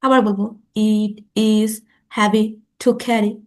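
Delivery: slow, word by word with long gaps; teacher modelling slow speech; slow practice pace. Shown as a demonstration. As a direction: drill each word slowly for learners. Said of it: "slow বলবো".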